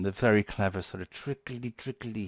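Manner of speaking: ordinary delivery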